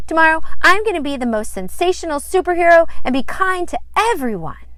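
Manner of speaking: put-on high, loud, fast excited child; a girl eagerly vowing to be kind. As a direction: rush it out in happy excitement.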